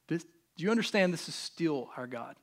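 usual speaking voice

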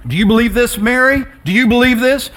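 high, insistent preacher's challenge; urgent calling. Retold or imitated. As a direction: press her hard for an answer. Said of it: "He's calling her to believe".

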